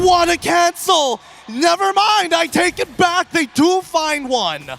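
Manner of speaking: thrilled high exclamation; excited shout. Real, not acted. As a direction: yell it out amazed. Co-caster reacts to play.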